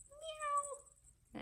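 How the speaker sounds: very high squeaky meow; a tiny high kitten mew; cat meow. Acted. Cat sound after reveal.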